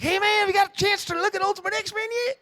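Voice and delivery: Kirkman. Retold or imitated. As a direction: play the eager caller. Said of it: "He's like".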